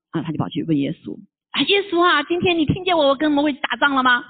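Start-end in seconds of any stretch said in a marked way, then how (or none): 1.5-4.3 calling Jesus. Acted. Young man calls to Jesus.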